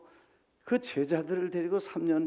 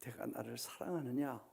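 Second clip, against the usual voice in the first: soft, low question; tender question; 5 semitones lower, 10 dB quieter than usual. Acted. Jesus asking Peter softly at breakfast, tender. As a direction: ask gently, almost private. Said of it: "베드로에게 묻습니다".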